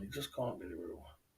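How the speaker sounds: low quiet mutter; muttered disbelief. Real, not acted. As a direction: say it under your breath in disbelief.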